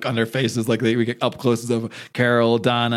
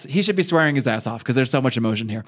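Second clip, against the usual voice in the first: ordinary delivery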